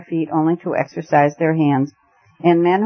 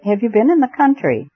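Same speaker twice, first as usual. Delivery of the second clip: high-pitched, mildly flustered question; rather wild; 7 semitones higher than usual. A narrator's reading. Host searching for words with returned friend, rather wildly. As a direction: ask it with a touch of wildness, pitch jumping up. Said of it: "he said rather wildly".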